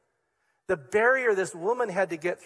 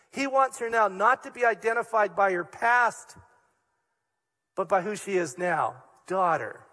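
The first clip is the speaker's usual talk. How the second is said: normal talking voice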